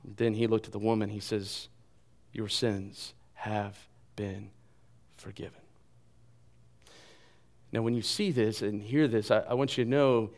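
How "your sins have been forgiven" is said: very soft, slow and low; hushed absolution. Acted. Preacher voices Jesus to the woman, hushed and slow. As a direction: let each word settle gently, dropping away at the end.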